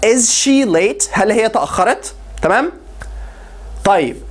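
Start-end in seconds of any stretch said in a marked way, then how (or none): 0.1-1.1 Is she late stretched out slowly; slow it right down; slow repeat. Shown as a demonstration. Teacher repeats the question slowly for students. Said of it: "repeat slow".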